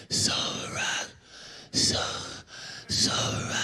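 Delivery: breathless whispered last words; dying whisper. Retold or imitated. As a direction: whisper like a dying old trainer. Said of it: "He's like".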